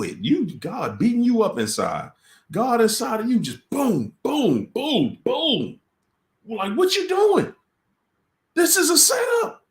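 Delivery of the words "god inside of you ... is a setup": high, loud urgent warning, much higher; alarmed inner voice. Retold or imitated. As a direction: build from pounding to a high alarmed cry.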